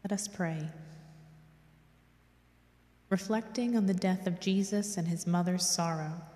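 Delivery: usual speaking voice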